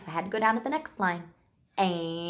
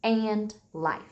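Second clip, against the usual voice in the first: slow dictation; slower than usual. Shown as a demonstration. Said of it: "come up with a sentence".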